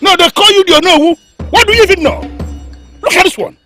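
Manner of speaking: high, loud scolding; enraged king silencing the adviser; furious tirade. Acted. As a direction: tear into the interrupter.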